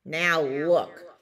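loud, crabby walrus; grumpy. A narrator's reading. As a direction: cut the chick off grumpily. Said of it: "grumped Missus Walrus".

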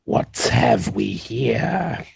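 very slow, drawn-out character voice; menacing Scar. Acted. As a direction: stretch each word out as a mean challenge. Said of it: "and he's going to go".

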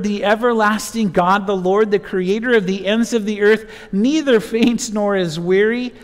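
ordinary talk

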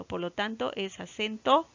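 ordinary delivery

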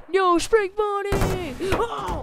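high, loud, slow pretend wail; anguished cry. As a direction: cry out over a fallen friend. Toy mourns fallen friend.

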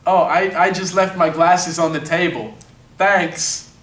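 put-on Ukrainian accent for an audition. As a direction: read the part in a dubious Ukrainian accent. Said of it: "I saw Kyle as being from the Ukraine".

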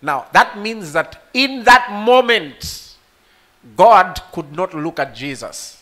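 loud, high, impassioned preaching; full-force preaching. Acted. Preacher crying out the cross story to the congregation, fervent.

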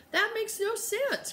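high indignant outburst; cried out. Acted. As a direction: protest in disbelief. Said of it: "cried Jessie".

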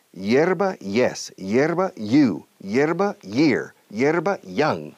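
"hierba" before each English y word, rhythmically; hierba lead-in drill. Shown as a demonstration. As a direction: let the glide of "hierba" carry into "yes, you, year, young". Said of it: "para forzar la voz a decir yes".

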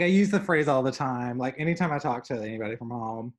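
usual speaking voice

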